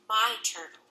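plain reading voice

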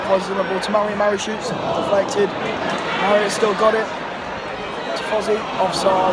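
rising, loud excited match call; live play-by-play. Real, not acted. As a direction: build the excitement as he shoots.

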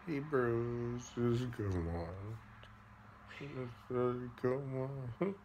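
chuckling through the words; amused, drawn-out praise; laughing. Real, not acted. Praising own pun, laughing.

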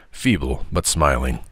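usual speaking voice

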